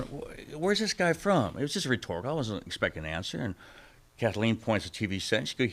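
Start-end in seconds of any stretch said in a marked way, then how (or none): 0.0-1.6 startled. Retold or imitated. Startled at same pastor.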